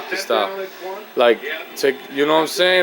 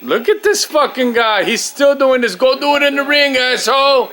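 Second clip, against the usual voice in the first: higher and louder, fed-up yelling at the screen; angry outburst; 9 semitones higher, 3 dB louder than usual. Real, not acted. Fan yelling at a boxer on the tape, angry and loud. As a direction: snap at the stalling boxer like he can hear you.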